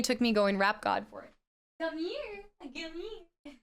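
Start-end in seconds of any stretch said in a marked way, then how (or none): 1.8-3.5 soft, high-pitched call to a pet; coaxing call. Real, not acted. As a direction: coax the cat over from off mic. Calling the cat away from mic.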